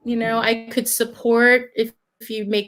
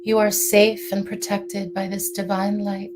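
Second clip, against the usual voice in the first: guided meditation; 2 semitones lower than usual. Acted. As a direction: guide them gently into calm.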